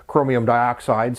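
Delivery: ordinary talk, nothing marked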